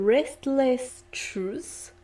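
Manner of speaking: the English title Restless Truth said slowly in a French accent; a shy French reading of the English title Restless Truth. Real, not acted. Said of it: "Ne jugez pas mon accent".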